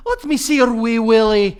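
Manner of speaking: high, very loud Scottish woman parody; female Scottish accent. Acted. Attempting a woman's Scottish, high and loud accent. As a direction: blare it high in broad Scots. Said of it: "like, a female Scottish".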